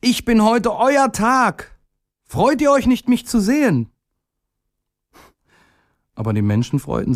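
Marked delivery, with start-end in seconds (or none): 0.0-3.9 put-on very high, eager childlike voice; high, hopeful call. A narrator's reading. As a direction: announce yourself to everyone, pitched way up. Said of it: "rief er".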